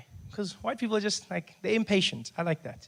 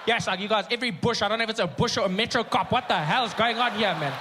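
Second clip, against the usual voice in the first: higher, shouting impatient rant; angry driver rant; 3 semitones higher than usual. Retold or imitated. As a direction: rage at the cop for stopping you.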